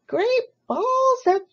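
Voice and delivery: high, very slow honking goose voice; goose crying out. Acted. Goose answers the others, slow high honk. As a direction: drag it out like a honk. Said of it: "cried the goose".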